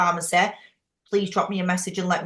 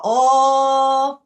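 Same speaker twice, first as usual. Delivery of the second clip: mock surprise; 4 semitones higher, 8 dB louder than usual. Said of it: "I thought".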